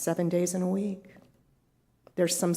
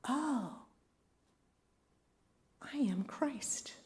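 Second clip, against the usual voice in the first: breathy whispered realization, higher; awed whisper; 6 semitones higher, 7 dB quieter, slower than usual. Retold or imitated. Inner voice at the aha moment, hushed whisper. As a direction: breathe it out like a sudden revelation. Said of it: "We're like".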